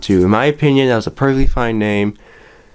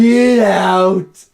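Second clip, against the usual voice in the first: high shouting boss; gravelly old tycoon; 9 semitones higher, 3 dB louder than usual. Pretend. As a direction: yell him out of the office. Boss yells at kid.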